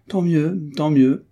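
merry, punchy repetition; cheerful. A narrator's reading. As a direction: echo it gaily, voice lifted. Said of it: "reprit gaiement Olivier".

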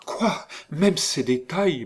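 high-pitched, stammering astonishment; shocked high exclamation; stupefied cry. A narrator's reading. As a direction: burst in, astounded, voice leaping up. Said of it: "l'interrompit par un cri de stupéfaction".

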